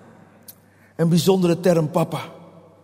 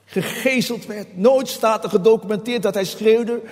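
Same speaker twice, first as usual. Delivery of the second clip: normal talking voice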